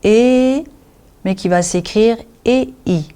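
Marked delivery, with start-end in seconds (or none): 0.0-0.6 E drawn out on a rising tone; long E. Shown as a demonstration. Teacher sums up how E is pronounced, long E. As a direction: hold E long, letting it climb. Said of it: "qui se prononce".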